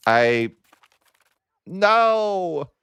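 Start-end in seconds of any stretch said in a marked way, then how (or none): 1.7-2.7 high, loud cry of dismay; a sudden wail of disbelief; anguished cry. Real, not acted. Gamer crying out as the dynasty falls, anguished no. Dynasty collapses, player cries out.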